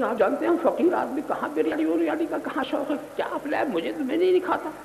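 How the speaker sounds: a hint of a pious man politely refusing; meek faqir voice. Host hears faqir decline biryani, meek.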